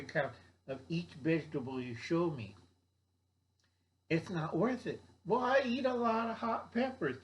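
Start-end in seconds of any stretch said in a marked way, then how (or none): 5.3-7.2 high mock voice of a gardener making excuses; a high voice mocking a smug gardener; mocking quote. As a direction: lift the pitch and play the excuse-maker.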